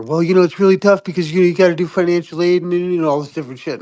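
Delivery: hammed-up bureaucrat rattling off excuses; excusing official. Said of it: "they were all like ... apologizing".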